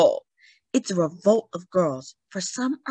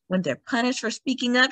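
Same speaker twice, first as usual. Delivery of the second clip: plain reading voice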